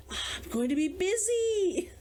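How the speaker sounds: plain reading voice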